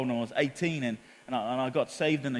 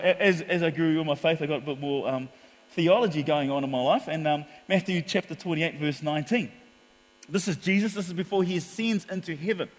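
ordinary talk, nothing marked

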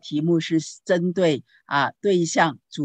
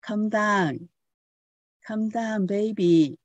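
gentle soothing; 4 semitones higher, slower than usual. Retold or imitated. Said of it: "声音很温柔的哈".

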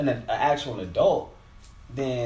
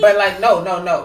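loud denial; 7 semitones higher, 12 dB louder than usual. Real, not acted.

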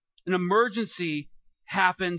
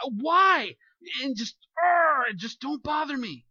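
irritated snap; 7 semitones higher, 6 dB louder, slower than usual. Retold or imitated. Acted snapping at an interrupter.

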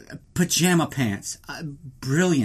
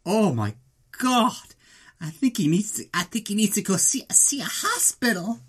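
put-on shocked girl; 6 semitones higher than usual. Girls watching the fight.

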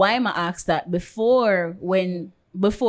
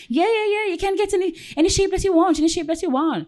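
high-pitched mock insistence; impatient brush-off; 12 semitones higher, faster than usual. Salon boss answering a client's shape choice, high impatient brush-off. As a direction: imitate someone hurriedly waving off a question.

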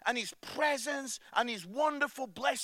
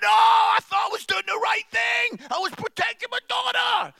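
much higher and louder, a flustered protesting dad; retold exasperated shout; 12 semitones higher, 11 dB louder than usual. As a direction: shout your defence, all over the place. Dad caught in family row.

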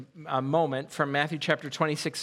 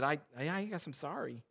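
sheepish apology; 3 semitones lower, 10 dB quieter than usual. Retold or imitated.

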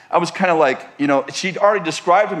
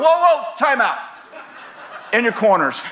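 higher, urgent call to stop; 5 semitones higher than usual. Retold or imitated. Pastor stopping the arguing couple, a raised call. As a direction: break up the fight like a referee.

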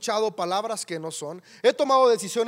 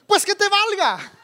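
loud, high, slow angry retort; snapping back; 10 semitones higher, 6 dB louder, slower than usual. Retold or imitated. Hungry father snaps at the shouting driver, loud and angry. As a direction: fire it back at the stranger. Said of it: "volteé y le dije".

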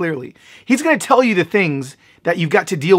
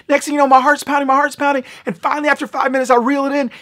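high, rushed excitement; breathless-paced, high-pitched account; excited storytelling; 7 semitones higher, faster than usual. Real, not acted. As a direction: race on in a high, pounding rush. Excited telling of landing the fish.

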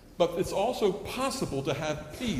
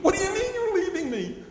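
disbelieving disciples; 5 dB louder than usual. Retold or imitated. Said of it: "they said".

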